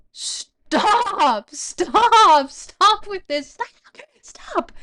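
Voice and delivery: high, very loud cries of stop; exasperated yelling. Real, not acted. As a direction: yell it high, at the end of your patience. Exasperated outburst at the difficult German text.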